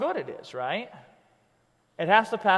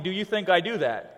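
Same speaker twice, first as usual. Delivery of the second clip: usual speaking voice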